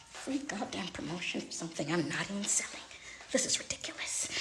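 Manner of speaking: annoyed whisper under her breath; whispered grumble. Real, not acted. Fed up with the free promo, whispering.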